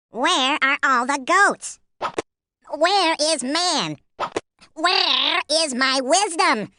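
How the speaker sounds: high and very slow, baffled; bewildered tiger. A narrator's reading. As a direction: drag out each question in confusion. Tiger bewildered, looking around.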